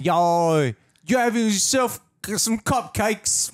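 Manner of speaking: high, loud, slow London caricature; mock London accent. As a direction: call the name out as a squawking cartoon Londoner. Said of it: "you sound like you're from London".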